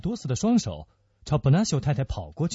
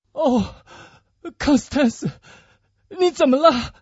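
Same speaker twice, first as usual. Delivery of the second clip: high, distraught cry; panicked grief, breaking off in distress; anguished; 11 semitones higher, 4 dB louder than usual. Acted. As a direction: plead in unspeakable anguish. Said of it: "痛苦得难以形容".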